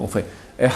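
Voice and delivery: "Ehad" with a stretched A, quiet; long A Ehad. Shown as a demonstration. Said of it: "on va rallonger le A". ("Ehad" avec le A allongé)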